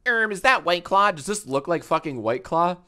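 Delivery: high, loud mock-nerdy quote; mocking chat commenter. Streamer reads out a viewer's chat question, mockingly. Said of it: "Someone in the chat said".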